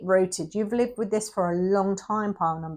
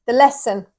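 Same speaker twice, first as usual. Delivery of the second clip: normal talking voice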